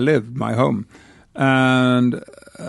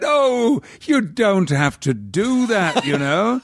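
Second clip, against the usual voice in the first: sub-editor; 10 semitones higher, slower than usual. Retold or imitated. Sub-editor voiced, higher.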